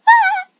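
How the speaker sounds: sudden high, loud shriek; startled scream. Real, not acted. Player jumped by zombies, startled scream.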